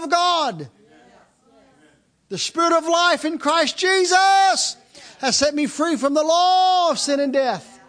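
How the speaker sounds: preacher rising to a roar, stretching every line; full-force preaching. Acted. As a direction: shout it to the congregation like a revival preacher. Preacher builds to a shouted climax.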